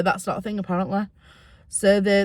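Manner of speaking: ordinary talk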